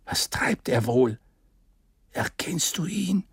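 half-whispered, breathy wondering; hushed question. A narrator's reading. Ardan asks about the distant captain, hushed. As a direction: ask it under your breath. Characters watching an enemy at distance.

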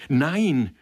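high, loud cry of refusal; shouted refusal; sudden scream. A narrator's reading. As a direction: cry out in protest. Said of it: "schrie sie auf".

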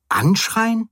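high-pitched incredulous echo; empörte Gegenfrage. A narrator's reading. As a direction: throw the word back in high disbelief. (empört und ungläubig, mit hoher Stimme)

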